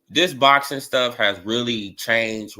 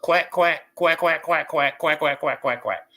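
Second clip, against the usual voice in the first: quacking like a duck to mock critics' talk; repeated mocking quack, quack. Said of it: "All duck".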